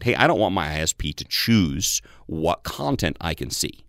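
usual speaking voice

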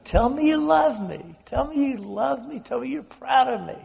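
high, pleading cry repeated; retold screaming. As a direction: sister screaming at father for love and pride. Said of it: "she is screaming over and over again".

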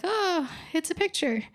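high-pitched little-sister coo; imitated sister's reaction. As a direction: put on a high voice admiring a photo.

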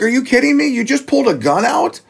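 high-pitched incredulous protest; retold incredulity. Retelling his reaction to the engineer's gun, incredulous. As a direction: pitch way up in disbelief.

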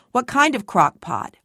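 ordinary delivery, nothing marked

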